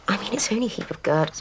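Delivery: ordinary talk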